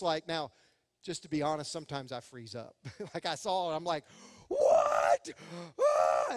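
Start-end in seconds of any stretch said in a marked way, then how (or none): 4.5-5.3 low, loud stunned cry; stunned what. Retold or imitated. Pastor reliving the flooded basement, stunned shout. 5.8-6.3 startled shout. Retold or imitated. Basement flooding panic.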